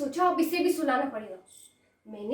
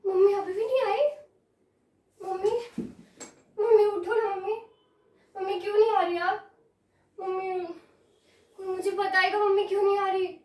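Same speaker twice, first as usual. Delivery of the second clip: put-on raised, needy child's voice; puzzled, insistent calling for mummy; pleading little girl; 6 semitones higher than usual. Child asking why mother will not come, pleading.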